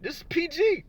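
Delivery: high prim this is PG; mock prim voice. Teasing Pat that the stream is PG, high prim voice. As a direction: put on a squeaky prim tone.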